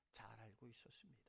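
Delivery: sudden hushed whisper, quick. Real, not acted. Pastor to the congregation, dropping suddenly to a whisper. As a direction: drop to a breathy secret whisper.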